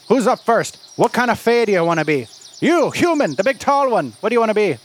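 high, lilting mock Irish brogue; brisk Irish leprechaun. Leprechaun asking adventurers what fae they want, in mock Irish. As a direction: play a cheeky leprechaun in fake Irish. Game master plays leprechaun.

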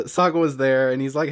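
normal talking voice

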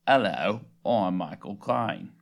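low, slow attempt at Cockney; unconvincing British star impression; Michael Caine. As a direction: try a London actor, deep and drawn out. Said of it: "I think it's my turn".

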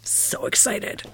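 soft breathy whisper. Real, not acted. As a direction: whisper it to yourself. Whispered echo of her squeal.

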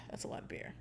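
hushed whisper; whispered concern. Retold or imitated. Family fretting over three beers, whispered. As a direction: whisper it like a secret worry. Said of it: "we were like".

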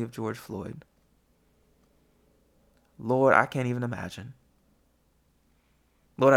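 hushed, earnest prayer voice fading out at the end; heartfelt prayer. Real, not acted. Leads a closing prayer.

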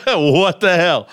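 slow, laughing what the hell; amused disbelief. Real, not acted. Streamer repeats himself, laughing slowly in disbelief at the rant. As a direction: drag it out, laughing at how wild it is.

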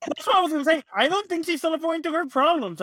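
excited, rapid correction; eager objection. Real, not acted. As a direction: cut in quickly to correct them.

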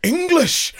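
high, loud, disgusted Scottish cry; explosive mock-horrified Scottish outburst; Scottish accent. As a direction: bellow it like an appalled Scotsman. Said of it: "that was the good Scottish accent".

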